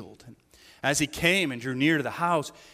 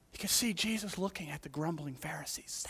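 whisper; 9 dB quieter than usual. Real, not acted. Hushed aside on the Pharisees.

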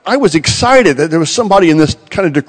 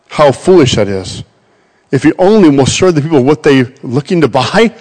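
ordinary delivery

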